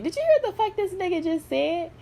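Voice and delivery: high-pitched mock disbelief; incredulous retelling. Vlogger quoting a man's video reaction, high incredulous voice. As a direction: act out someone stunned by what they heard.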